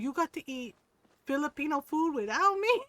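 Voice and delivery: high, loud mock outrage; jealous. Mom learns her son ate Filipino food without her, jealous. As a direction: protest like a left-out mom. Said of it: "he said his mom was jealous".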